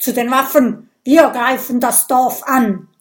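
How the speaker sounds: rally the men to arms; rousing shout. A narrator's reading. Viking leader orders the attack on the village, shouting.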